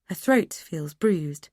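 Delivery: ordinary delivery, nothing marked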